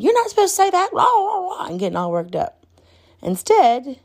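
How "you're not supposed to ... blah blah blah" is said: high, mocking nag voice; scolding adult. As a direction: parody an uptight scolding teacher. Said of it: "instead of me saying".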